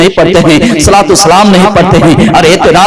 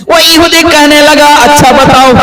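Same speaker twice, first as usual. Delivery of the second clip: raised, sing-song preaching of the story; 7 semitones higher than usual. Acted. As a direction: preach the tale to the gathering.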